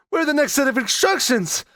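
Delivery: hammed-up panic; mock helplessness. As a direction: pitch climbing into mock panic. Mock helplessness after instructions.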